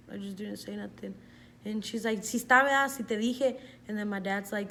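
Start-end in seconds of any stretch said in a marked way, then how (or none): 2.2-3.6 louder, higher mock-mom accusation; retold scolding mom. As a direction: scold like a mother proved right. Angry mom voiced in story.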